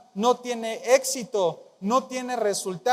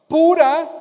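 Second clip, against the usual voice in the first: 1 emphatic; 7 semitones higher, 11 dB louder than usual. Real, not acted. Preacher reading scripture emphatically.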